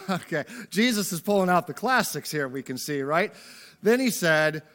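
ordinary delivery

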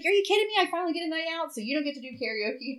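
high, mock-outraged complaint; indignant protest. Retold protest at losing night out.

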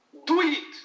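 high, loud do it; doctor shouting the slogan loud and high; shouted command. Retold or imitated. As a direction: bark the slogan like a coach.

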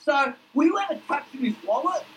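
high sly question; high cheeky bloke; Duck's voice. Acted. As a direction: pitch up, nosy and cheeky. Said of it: "And then Duck says".

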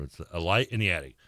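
ordinary talk, nothing marked

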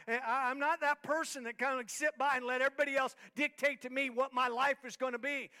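normal talking voice

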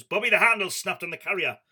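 retold wife calling upstairs, high and loud; shouting up. Wife shouting up to Bobby while packing, loud call. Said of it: "and she shouts up".

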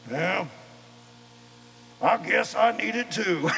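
a hint of a gruff son giving in; tough guy son. The tough logger son admits he wants baptism too, in a mild gruff voice. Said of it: "he's like".

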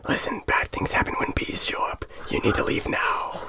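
low, breathy, menacing demon whisper; demonic whisper. Acted. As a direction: hiss it like a snaky demon. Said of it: "kinda like a snaky demon".

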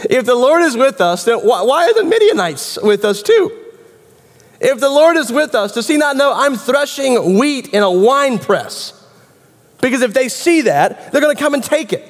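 high-pitched run of grievances; complaining question. Acted. Gideon threshing wheat in a wine press, complaining. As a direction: keep protesting, pitched well up. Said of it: "is a complaint in the form of a question".

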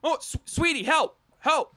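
high, pleading call for help; pleading wife voice. Acted. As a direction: beg the husband for help.

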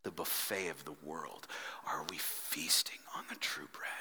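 whispered, intimate question. Real, not acted. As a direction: whisper it close to the mic. Preacher whispers repeated question.

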